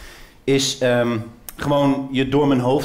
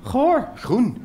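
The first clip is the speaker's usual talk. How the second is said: put-on high squeaky toddler voice; small child; 13 semitones higher, 3 dB louder than usual. Toddler answering his mother in the supermarket, squeaky. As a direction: answer like a little kid.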